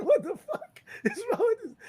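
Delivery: very high, squeaky mock outrage; laughing disbelief. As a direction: crack up while acting appalled. Retold outrage at friend, laughing.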